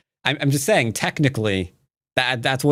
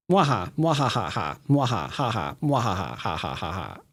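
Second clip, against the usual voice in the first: mwaha laugh, fading softer; evil villain laugh; 2 semitones lower, 4 dB quieter than usual. Acted. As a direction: cackle like a cartoon villain. Spelt evil laugh after taunts.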